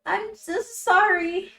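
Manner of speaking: high, loud, slow blurted sorry; panicked apology. Acted. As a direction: blurt it out then flee.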